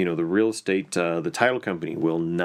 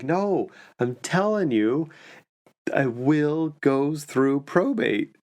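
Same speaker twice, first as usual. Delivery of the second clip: higher, slow, dragged-out insistence; the retired CPA digs in, slowly and higher; insistent; 6 semitones higher, slower than usual. Retold or imitated. Diner insisting to his friend, slow and higher-pitched. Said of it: "the guy was like".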